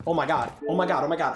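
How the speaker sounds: ordinary talk, nothing marked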